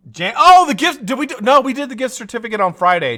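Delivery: high, loud sudden remembering; sudden realization. Real, not acted. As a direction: call out, suddenly recalling. Suddenly remembering, calling to Jane.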